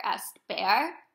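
usual speaking voice

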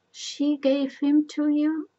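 high, loud, slow disbelief; shocked question. A narrator's reading. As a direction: draw out the astonished question. Oedipus is shocked by the revelation.

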